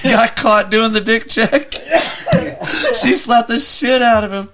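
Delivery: high, loud, laughing hard; cracking up, voice shooting up high; real helpless laughter. Real, not acted. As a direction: shout it through big laughs.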